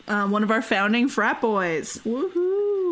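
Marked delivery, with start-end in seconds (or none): 2.1-2.9 mock cheer. Cheering the frat boy.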